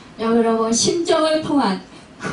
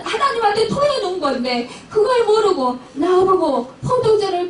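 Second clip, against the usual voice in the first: higher-pitched, aggrieved protest; hurt protest; 4 semitones higher than usual. Acted. Praying woman protesting the priest who called her drunk, aggrieved. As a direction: protest in pain at being misread, pitch raised. Acted protest of the misjudged Hannah.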